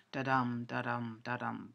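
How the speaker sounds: steady heartbeat rhythm, light "da", weighted "dum"; iambic stress. Shown as a demonstration. Lecturer demonstrates English verse rhythm, "da-dum" stressed on every "dum". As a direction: chant "Da-dum, da-dum, da-dum" evenly, each "dum" stressed. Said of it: "first syllable unstressed and the second stressed".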